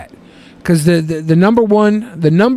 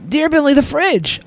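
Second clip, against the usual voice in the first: higher, theatrical letter read; letter read aloud; 5 semitones higher than usual. Acted. Host reading a fan question to co-hosts, theatrical. Reads second listener letter.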